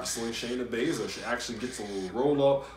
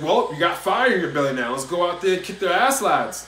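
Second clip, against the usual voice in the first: loud, clumsy Scottish impersonation; rousing pep talk in bad Scottish; bad Scottish accent; 5 semitones higher, 6 dB louder than usual. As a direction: put on an unconvincing Scottish accent, loudly. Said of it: "that was a bad Scottish accent".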